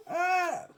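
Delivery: high laughing yelp; sudden surprised laugh-yelp; startled yelp. Real, not acted.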